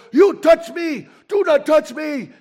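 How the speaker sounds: high shrill-free yelling outrage; furious passenger screaming at the preacher. Retold or imitated. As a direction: shout it at the seatmate, high and loud. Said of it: "And he's screaming at me".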